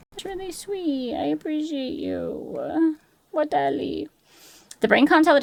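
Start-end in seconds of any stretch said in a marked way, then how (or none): 0.1-2.9 higher, much softer and slow, like a shy little creature; teeny mock-cute thank-you; tiny cutesy voice. Cutesy voice reading chat. 3.3-4.1 teeny mock-cute thank-you; tiny cutesy voice. Cutesy voice reading chat.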